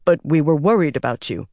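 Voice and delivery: ordinary delivery, nothing marked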